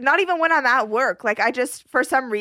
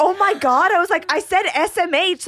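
panicked protest; 7 semitones higher than usual. Retold or imitated.

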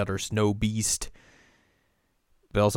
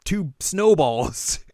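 mock shock; 9 semitones higher, 6 dB louder than usual. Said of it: "he turns around".

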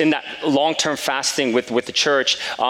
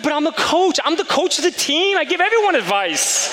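laughing protest. Retold or imitated.

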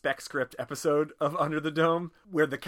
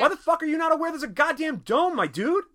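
high, loud, incredulous mock rant; exasperated outburst; 8 dB louder than usual. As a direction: blow up at an oblivious friend, pitch way up. Acted exasperated outburst at Jim.

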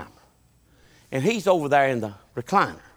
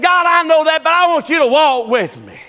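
high, loud preaching cry; fervent plea; 14 semitones higher, 7 dB louder than usual. Acted. Moses pleading for God to walk along, loud fervent plea.

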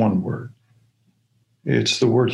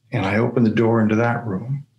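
ordinary talk

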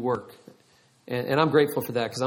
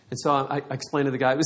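normal talking voice

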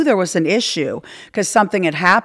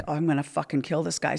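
lower, much softer grumble to herself; muttered under breath; 4 semitones lower, 10 dB quieter than usual. Retold or imitated. Fed-up patient grumbling to herself about the doctor, softly muttered. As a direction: mutter it so nobody hears. Inner thought while kept waiting.

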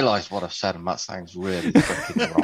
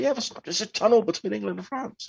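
retold explanation; 13 semitones higher than usual. Retells explaining tunnel to amazed colleague.

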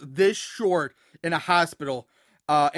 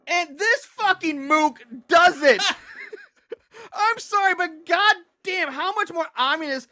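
higher, louder disbelief; exasperated; 9 semitones higher, 3 dB louder than usual. Real, not acted. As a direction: rant in disbelief. Exasperated outburst at character.

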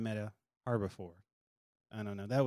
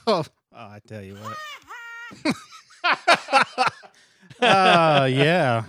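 laughing hard; 7 semitones higher, 7 dB louder than usual. Real, not acted. Big laughter at joke.

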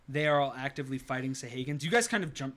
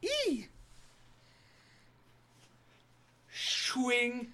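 high, loud battle cries; shouted warning; 12 semitones higher, 10 dB louder, much slower than usual. Acted. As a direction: yell orders across the deck. GM voices pirate calling out.

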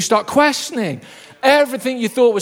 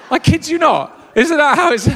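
usual speaking voice

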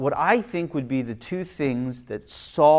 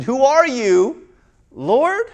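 slower, wondering "Who are you, Lord?" with a rise; questioning; 11 semitones higher, 8 dB louder, slower than usual. Acted. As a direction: ask it in awe. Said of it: "Or maybe it was a question".

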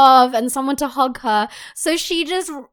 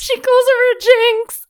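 outraged; 12 semitones higher, 5 dB louder than usual. Real, not acted. Outraged retelling.

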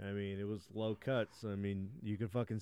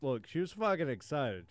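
usual speaking voice

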